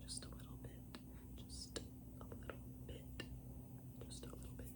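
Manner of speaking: very soft whisper to oneself. Real, not acted. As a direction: whisper it under your breath, barely audible. Coaxing herself through a small adjustment.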